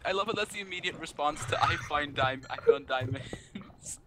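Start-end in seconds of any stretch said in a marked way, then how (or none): none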